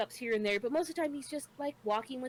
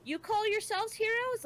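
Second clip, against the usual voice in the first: indignant little dragon; 10 semitones higher than usual. Retold or imitated. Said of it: "He's like".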